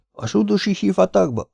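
very high, aghast incredulous question; horrified echo. A narrator's reading. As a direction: repeat it high-pitched in disbelieving dread. Ahmed's horrified echo of the threat. (elszörnyedve, magas hangon visszakérdezve)

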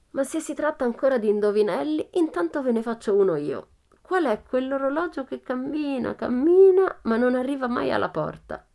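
put-on high, loud child's riddle, cammina cammina leaping up; sing-song riddle to the burglars; playful girl riddle. A narrator's reading. Pippi poses a riddle. (indovinello giocoso, acuto e forte)

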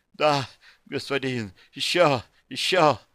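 eager moaning. A narrator's reading. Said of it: "не унималась Викара".

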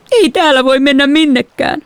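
high, tearful wail; tearful cry. A narrator's reading. Little sister wailing tearfully at the dinner table. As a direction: wail it close to tears. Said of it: "hän huudahti itkuisesti".

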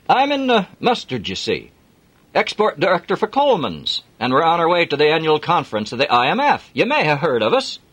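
hearty Yorkshire driver; broad Yorkshire accent. Acted. Jim offering Adam a lift, broad Yorkshire accent. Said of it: "broad Yorkshire accent".

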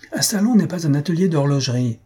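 high-pitched, acted indignation; heated retort. A narrator's reading. Host to clockmaker in his salon, heated retort. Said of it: "répliqua Jujube avec emportement".

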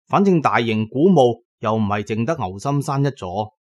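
ordinary talk, nothing marked

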